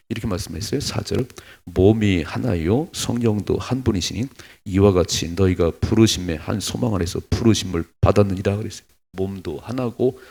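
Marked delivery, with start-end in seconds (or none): 1.7-8.6 a pastor reading the Bible aloud, solemn and loud; solemn scripture reading. Acted. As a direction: proclaim the verse to the congregation. Reads Ephesians aloud.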